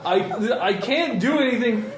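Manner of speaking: ordinary talk, nothing marked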